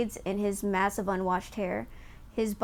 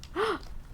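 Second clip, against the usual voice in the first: surprised gasp; 10 semitones higher, 8 dB quieter than usual. Acted. Surprised at seeing Ulfar.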